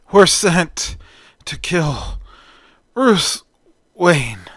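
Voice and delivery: halting words with gaps between them; struggling to speak. A narrator's reading. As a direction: force each word out like a beaten man. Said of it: "struggling to speak".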